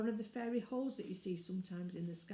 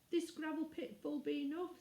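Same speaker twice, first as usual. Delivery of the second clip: offhand; 8 semitones higher than usual. Acted. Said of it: "in an offhand manner".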